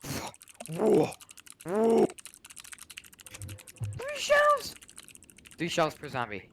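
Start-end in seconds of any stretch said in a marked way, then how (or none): 0.0-4.8 high disbelieving cry; shocked yell. Real, not acted. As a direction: voice jumps way up. Shocked at ammo count.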